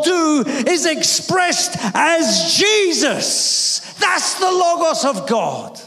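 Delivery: high-pitched, emphatic sermon climax; stretched key words, ringing preacher's declaration; impassioned preaching. Real, not acted. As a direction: drive each phrase home to the congregation. Preacher builds to full force.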